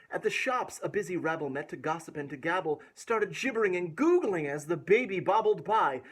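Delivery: put-on lilting read-aloud rhythm for a child; rhymed story reading. A narrator's reading. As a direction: bounce through the rhyming verse. Rhyming verse continues.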